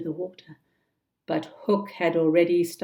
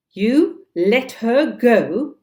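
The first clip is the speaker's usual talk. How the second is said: loud, slow, outraged question; yelled in disbelief; 5 semitones higher, 10 dB louder, much slower than usual. Acted. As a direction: yell it, stunned and furious. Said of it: "yelled Hook".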